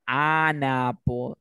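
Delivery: "An apple" slowly, stretching the AN; each word of "An apple" drawn long; drawn-out model pronunciation. Shown as a demonstration. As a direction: model "An apple" for learners, very slow. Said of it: "Decimos an".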